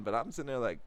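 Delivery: ordinary delivery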